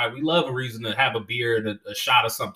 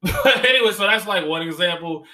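ordinary talk

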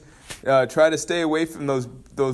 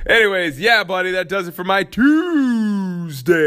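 NBA announcer; 6 semitones higher than usual. Pretend. Podcaster wraps up his Tuesday episode as a mock NBA announcer. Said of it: "like an N B A announcer".

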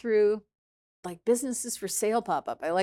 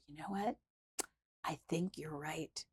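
retold meek admission; 3 semitones lower, 12 dB quieter than usual. Admitting fault to her accuser in the meeting, soft meek surrender. Retells giving in before the tears.

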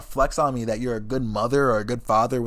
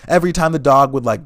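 ordinary talk

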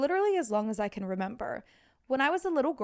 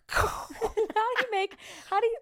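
silly high voice; 10 semitones higher than usual. Pretend.

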